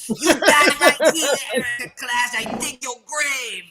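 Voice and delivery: high, loud mock taunt; in character taunt. Imitating the queen taunting Erica Clash, high and loud. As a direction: taunt her rival like a wrestler. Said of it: "in character and she's going".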